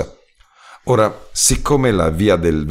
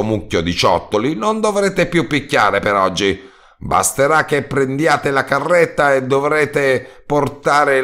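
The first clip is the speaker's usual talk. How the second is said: relieved roadman; 8 semitones higher than usual. A narrator's reading. Roadman giving the narrator instructions for the cart, higher. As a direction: hand over the job happily.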